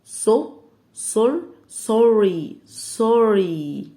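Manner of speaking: سوري stretched out slowly; slow sounding out. Shown as a demonstration. Teacher sounding out a word for the class. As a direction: build up سوري syllable by syllable. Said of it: "يعني سو".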